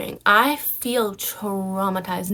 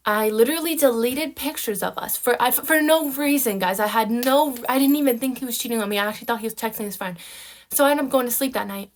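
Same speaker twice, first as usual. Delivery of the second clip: usual speaking voice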